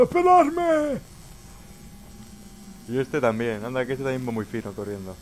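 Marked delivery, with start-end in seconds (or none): 0.0-1.0 high, loud, breathless cry of a straggler; mocking a panting runner. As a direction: squeal it like someone left behind, gasping. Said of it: "echando la vida por la boca diciendo". (imitando a un corredor sin aliento, agudo y fuerte)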